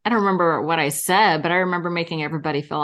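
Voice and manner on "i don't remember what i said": usual speaking voice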